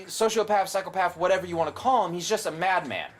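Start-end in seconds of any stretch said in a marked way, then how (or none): none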